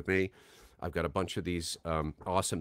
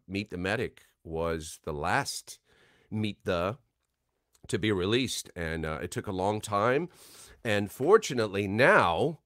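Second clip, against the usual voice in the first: ordinary delivery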